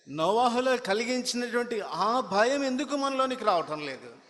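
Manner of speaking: high, loud fiery preaching; preaching at full force. Acted. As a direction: drive the rebuke home from the pulpit. Preacher rebukes congregation. (ఉద్రేకంగా బిగ్గరగా ప్రసంగం)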